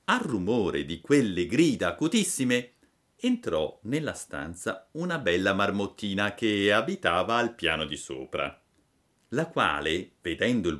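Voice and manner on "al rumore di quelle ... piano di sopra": ordinary delivery, nothing marked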